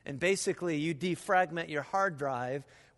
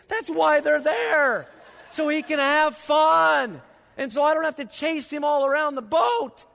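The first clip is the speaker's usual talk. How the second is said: emphatic raised voice; 8 semitones higher, 8 dB louder than usual. Real, not acted. Pastor insists to the congregation the characters are there for fun, loudly. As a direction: push it hard, almost exasperated.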